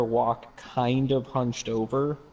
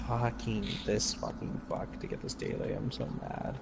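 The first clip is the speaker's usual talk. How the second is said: muttered frustration; frustrated muttering; 9 dB quieter than usual. Real, not acted. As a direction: grumble it under your breath, unvoiced. Frustrated muttering under breath.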